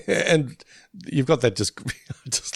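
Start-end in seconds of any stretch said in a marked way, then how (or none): none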